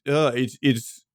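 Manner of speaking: normal talking voice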